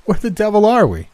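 stammer, then burst out bewildered; bewildered outburst. A narrator's reading. Davidson asks his friend where they are, bewildered. Bewildered man who cannot see.

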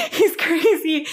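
high, slow words through a laugh. Real, not acted. Teller repeats the verdict on the accused, laughing. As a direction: draw it out high while laughing.

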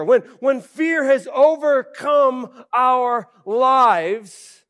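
loud, slow, emphatic sermon peak; preaching at full force. Acted. Preacher warns the church about fear, loud and slow. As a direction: drive it home to the congregation.

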